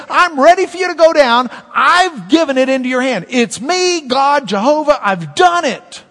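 God's voice, high and loud, declaring victory; booming voice of God. Acted. Preacher voicing God to Gideon, loud and commanding. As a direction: proclaim it like God handing over the battle.

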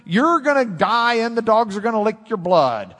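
loud, high, thundering doom; preacher voicing a prophet condemning the king, booming warning; prophet's warning. A prophet thundering judgment at the king, loud and high.

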